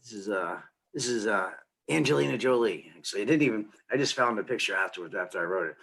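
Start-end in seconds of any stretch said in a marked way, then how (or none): none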